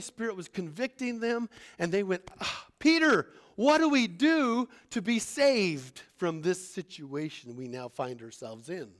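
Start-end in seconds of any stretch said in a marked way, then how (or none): 2.4-8.9 desperate crowd. Acted. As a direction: beg Peter for rescue. Crowd begs Peter.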